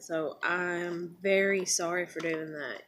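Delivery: soft, low, slow apology; earnest apology. Retold or imitated. Teller apologizing to boy pulled aside, quietly earnest. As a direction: say it slowly and quietly, really meaning it.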